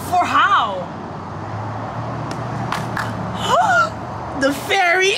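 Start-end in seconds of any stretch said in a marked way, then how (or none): 0.9-4.4 high gasping sob; breathless gasp. Real, not acted. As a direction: gasp in disbelief. Stunned by London news.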